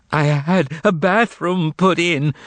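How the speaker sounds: high, slow, frightened pleading cry; terrified cry. Acted. Bloodied informer begging, terrified cry. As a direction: cry out desperately for mercy. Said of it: "cried the bloodied Mogarich".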